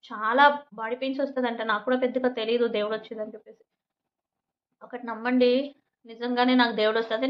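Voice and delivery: normal talking voice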